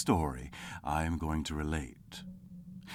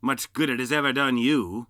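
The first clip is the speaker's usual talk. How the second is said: louder sour sneer; 7 semitones higher, 8 dB louder than usual. Acted. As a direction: scoff at him. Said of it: "said Scrooge".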